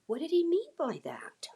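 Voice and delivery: hushed, acted pondering; puzzled whisper. Puzzling over her co-star's remark, acted whisper. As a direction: wonder it aloud under your breath.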